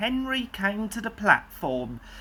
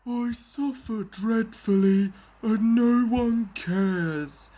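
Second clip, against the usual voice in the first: self-pitying whine; 3 semitones higher than usual. A narrator's reading. Henry moaning about his health.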